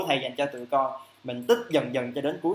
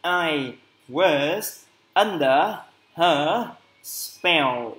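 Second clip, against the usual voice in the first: very slow, a bit louder English phrase; slow model phrase; 3 dB louder, much slower than usual. Shown as a demonstration. Teacher models the answer phrase slowly and clearly. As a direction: stretch out "I was under" slowly. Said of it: "câu mang tính chất cụm cố định".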